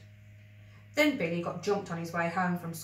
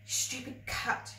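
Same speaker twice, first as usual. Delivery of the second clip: soft slow unvoiced whimper; a hushed, broken whimper over the cat; 6 semitones higher, 4 dB quieter, slower than usual. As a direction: breathe it out, holding back tears. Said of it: "he whimpered".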